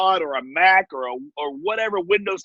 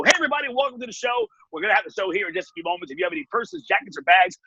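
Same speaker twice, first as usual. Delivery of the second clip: fast, bright announcer patter, pitched up; pre-show announcer; faster than usual. Retold or imitated. Backstage host warming up the audience, fast announcer patter. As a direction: hype the crowd from backstage like a quick-talking emcee. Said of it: "I literally sit backstage and I go".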